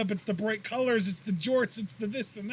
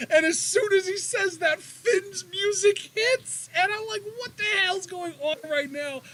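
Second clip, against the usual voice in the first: much higher, near crying with excitement; ecstatic retelling; 11 semitones higher than usual. Real, not acted. Telling cohost about the surprise, ecstatic and cracking. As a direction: pitch way up, voice cracking with glee. Retelling Finn's music hitting.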